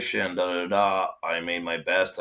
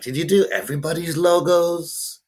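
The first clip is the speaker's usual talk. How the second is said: higher, louder astonished question to a friend; amazed disbelief; 8 semitones higher, 4 dB louder than usual. Retold or imitated. Tattoo artist quoting his amazement at a friend's logos, higher and louder. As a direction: pitch jumps up in disbelief. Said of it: "I'm like".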